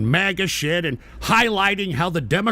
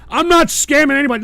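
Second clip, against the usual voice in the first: raised, higher-pitched angry protest; angry denial; 8 semitones higher, 7 dB louder, faster than usual. Real, not acted. Streamer answering chat's scam accusers, angry and loud. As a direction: snap back loudly, indignant.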